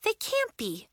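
high-pitched denial; incredulous, worried objection; disbelief. A narrator's reading. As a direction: refuse to believe it. Girl refuses to believe friends left.

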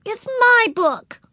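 put-on very high, slow, loud little-girl voice; insistent small child. A narrator's reading. Ramona claiming the library book as hers, high and insistent. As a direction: drag it out like a possessive little kid.

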